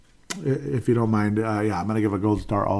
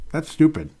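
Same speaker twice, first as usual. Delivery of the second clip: that's stupid, pushed up and louder; louder, higher repeat; 6 semitones higher, 4 dB louder than usual. Real, not acted. Repeating his scorn for the losing mindset, louder. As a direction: hammer home the scorn.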